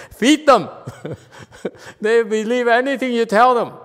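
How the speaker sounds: high, loud, amused joking; real laughter breaking into loud teasing; laughing. Real, not acted. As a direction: laugh between phrases while joking about your own group. Joking about the cult, laughing.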